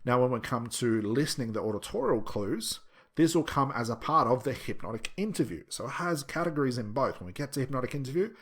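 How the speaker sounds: ordinary delivery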